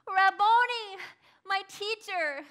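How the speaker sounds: high, loud cry of recognition; joyful cry. Acted. Mary recognizes Jesus and cries out to her teacher. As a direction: cry out his name in overwhelming joy. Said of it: "I began to cry aloud".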